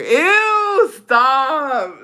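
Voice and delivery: high, loud drawn-out ew; disgusted squeal. Real, not acted. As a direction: squeal in revulsion. Disgusted outcry at retold couple's line.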